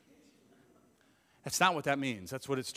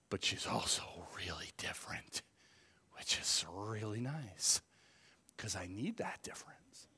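1 whispered aside; 7 semitones lower, 7 dB quieter than usual. Real, not acted. Hushed aside.

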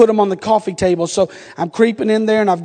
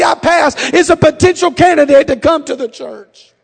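higher, louder, shouted preaching; full-force preaching; 8 semitones higher, 5 dB louder than usual. Acted. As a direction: build the plea to a pulpit roar.

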